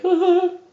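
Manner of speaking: very high falsetto "oh". Shown as a demonstration. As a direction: flip up into a light falsetto hoot. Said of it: "with a normal falsetto voice".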